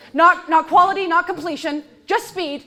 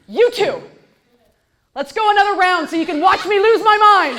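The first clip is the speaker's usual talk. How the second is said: mock sarcastic invitation; 4 semitones higher, 6 dB louder than usual. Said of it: "what they must hear".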